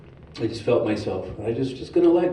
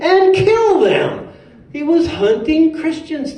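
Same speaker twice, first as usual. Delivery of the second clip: much higher and louder, slow and punchy; dramatic outrage; 13 semitones higher, 6 dB louder, slower than usual. Acted. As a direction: hit it like a shocked preacher. Dramatic sermon telling.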